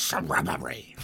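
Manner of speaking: dropped low and breathy; Monty Python shrubbery. Retold or imitated. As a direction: deliver the punchline like the Knights of Ni. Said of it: "Monty Python, everybody".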